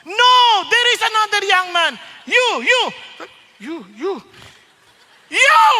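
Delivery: calling him out of the crowd with a final high yell; a preacher pointing into the crowd and shouting You; preacher singling him out. Retold or imitated.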